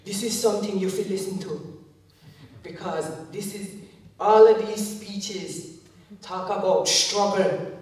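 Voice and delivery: put-on father's emphatic Jamaican accent, slow and weighty; imitated Jamaican dad, loud and drawn-out; thick Jamaican accent. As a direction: lecture in a thick Jamaican accent, leaning on key words. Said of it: "thick Jamaican accent".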